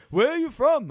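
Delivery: high, loud eager question; rug seller. Retold or imitated. Seller sizing up a tourist, high and loud.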